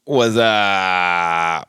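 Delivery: long drawn-out catchphrase holler, sliding downward; early 2000s commercial impersonation. Acted. Guest greeting the podcast host with an old commercial's catchphrase, drawn-out impersonation. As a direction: stretch the greeting out like a silly ad catchphrase. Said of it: "my early two thousands commercial impersonation".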